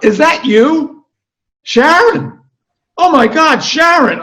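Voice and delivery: very high, loud delighted shriek; second lady yelling back with high shrieks; yelling back excitedly. Acted. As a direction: scream her name back across the sidewalk. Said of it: "Eileen yells back at her".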